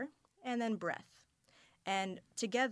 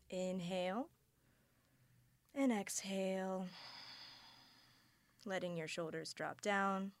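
calm grounding exercise; 4 dB quieter than usual. Acted. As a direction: guide them into their bodies, unhurried.

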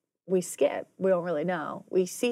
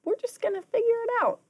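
fast, high, mock-cheerful shrug; mock breezy reassurance; 14 semitones higher, much faster than usual. Mimicking Mrs Hall's cheerful calm, high and fast. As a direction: toss it off like it's no big deal.

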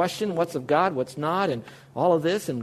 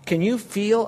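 ordinary delivery

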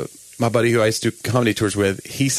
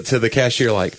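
usual speaking voice

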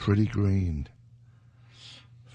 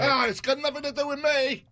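mock denial; faster than usual.

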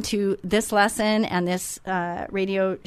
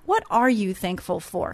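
usual speaking voice

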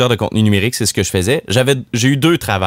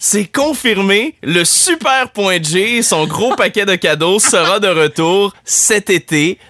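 hype announcer; 8 semitones higher than usual. Pretend. Radio host announcing a superhero's return to listeners, hyped announcer. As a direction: announce it grandly, pitched well above normal talk.

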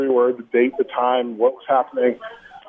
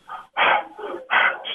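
a quick, shallow, airy exhale; demonstrating a shallow breath; shallow breath sound; 3 dB quieter than usual. Shown as a demonstration. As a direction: breathe out short and shallow to show the struggle. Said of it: "shallow breaths".

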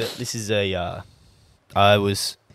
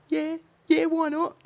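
very high-pitched querying imitation; questioning mimicry; 4 dB quieter than usual. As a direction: squeak every phrase up like a question. Acting out texts full of question marks.

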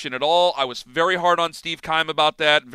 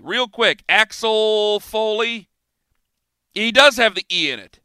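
ordinary delivery

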